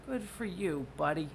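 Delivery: polite indulgence. Retold or imitated. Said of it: "and they're like".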